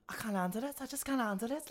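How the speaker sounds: high, lilting mock Scouse; Liverpudlian accent. Shown as a demonstration. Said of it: "is this Liver-, Liverpudlian?".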